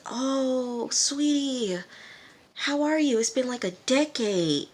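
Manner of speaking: high, drawn-out gushing hello; delighted greeting. Retold or imitated. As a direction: coo it like a grandma greeting a long-lost grandchild. Grandma greets long-lost grandson.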